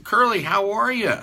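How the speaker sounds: high, loud, delighted hello; hearty greeting. Retold or imitated. Father hears Curly on the phone, hearty greeting. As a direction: greet a welcome caller with gusto.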